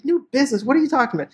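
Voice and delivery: high-pitched, imitated disbelief; incredulous wife. Wife questions husband's new business, mock incredulity.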